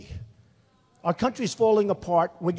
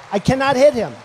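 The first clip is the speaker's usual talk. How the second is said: emphatic; 3 semitones higher, 10 dB louder than usual. Real, not acted. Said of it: "It's true".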